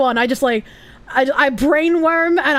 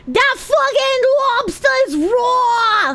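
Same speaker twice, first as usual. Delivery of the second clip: very high, drawn-out mock tirade; mock outraged chef; 12 semitones higher, much slower than usual. Joking streamer yelling about raw lobster, mock outrage. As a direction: wail it slowly, way up high.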